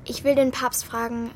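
plain reading voice

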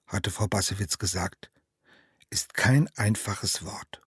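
ordinary delivery, nothing marked

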